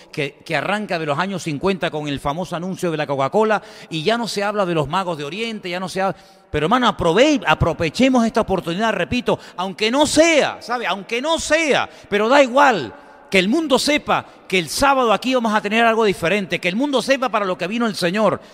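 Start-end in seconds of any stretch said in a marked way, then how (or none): 6.5-18.4 louder and higher, building to a shout; full-force preaching. Real, not acted. Preacher urging brothers to invite people, shouted fervour. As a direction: rouse the church to act this Saturday.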